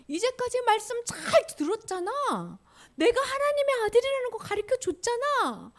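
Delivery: high, insistent reproof; Jesus. Acted. Jesus asking disciples why they lack faith, high reproachful voice.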